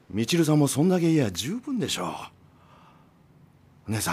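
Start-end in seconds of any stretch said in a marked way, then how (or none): none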